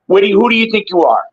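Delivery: ordinary delivery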